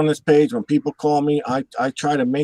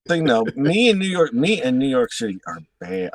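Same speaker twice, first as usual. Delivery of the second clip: ordinary talk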